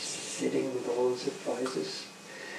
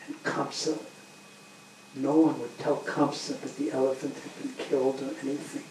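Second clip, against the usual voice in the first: plain reading voice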